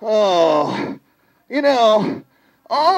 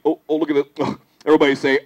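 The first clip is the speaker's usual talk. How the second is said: normal talking voice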